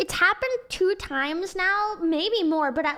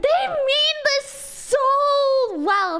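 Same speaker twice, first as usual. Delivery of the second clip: drawn-out, high, overdone sweetness; exaggerated sincerity; 11 semitones higher, 4 dB louder, much slower than usual. Real, not acted. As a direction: stretch it out like a forced smile.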